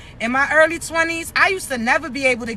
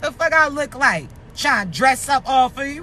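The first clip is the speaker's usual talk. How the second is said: usual speaking voice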